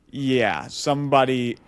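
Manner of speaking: ordinary talk, nothing marked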